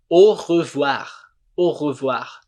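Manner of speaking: "au revoir" slowly, starting on a pure oh; "au revoir" said o-ruh-vwar; French au as O. Shown as a demonstration. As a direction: model "au revoir" with au as oh and throaty R. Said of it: "a gente fala o".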